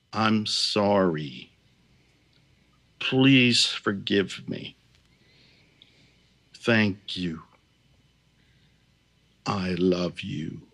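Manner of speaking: slow, reverent prayer, words spaced far apart; mean every word, slowly and reverently; reverent, sincere. Shown as a demonstration. Healer modelling the four phrases for listeners, slow and reverent. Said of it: "reverently, genuinely saying inside yourself".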